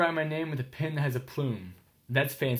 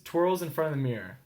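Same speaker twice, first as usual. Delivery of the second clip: ordinary delivery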